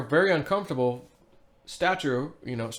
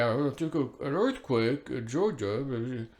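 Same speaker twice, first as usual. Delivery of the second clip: very slow, softer mock official voice; 4 dB quieter, much slower than usual. Scoffing at the earthquake story in a soft, slow mock voice. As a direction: drag out the earthquake excuse scornfully. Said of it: "Give me an effing break".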